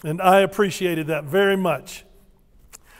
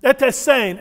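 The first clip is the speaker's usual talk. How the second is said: higher, punchy Ete, ete sane; gruff Twi greeting; 5 semitones higher, 4 dB louder than usual. Shown as a demonstration. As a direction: sound almost mad at them. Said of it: "Sounds like they're mad at you".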